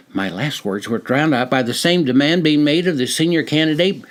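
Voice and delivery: usual speaking voice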